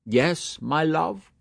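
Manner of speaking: a hint of teasing mockery, slowed; mock outrage, playful; lightly voiced jester. A narrator's reading. Mulligan teasing Stephen about his name, light mock scorn.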